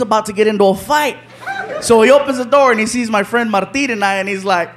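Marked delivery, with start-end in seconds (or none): none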